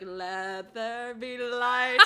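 slow, drawn-out booming decree; mock-grand proclamation. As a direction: proclaim it like a playful deity. Retold triumphant flipping of switch.

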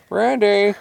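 high, loud cat call. A narrator's reading. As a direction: call a friend back by name. Hunters call Randy back.

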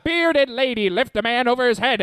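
put-on high old-timey Mid-Atlantic showman; Mid-Atlantic sideshow hype; Mid-Atlantic accent. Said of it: "best Mid-Atlantic impression".

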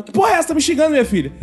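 offended mother. Retold or imitated. Mother thinks her daughter cursed her, high mock indignation. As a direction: imitate a mother taking offence on the phone. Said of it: "a mãe dela falou".

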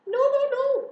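very high, loud protest; alarmed goose. A narrator's reading. Goose seeing pig approach pail, alarmed. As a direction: squawk a frantic objection. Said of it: "said the goose".

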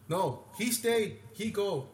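slow, high, emphatic ruling; imitated security guard. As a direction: lay down the verdict word by word. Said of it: "And he goes like that".

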